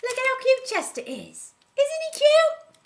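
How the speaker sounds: high, loud cooing over a pet; gushing delight. Acted. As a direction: squeal over how adorable he is. Gushing over the cute reindeer.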